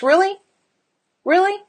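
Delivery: high, rising "Really?"; sceptical challenge. Real, not acted. Coach questioning mothers who put children first, sceptical. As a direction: lift "Really?" into pointed disbelief.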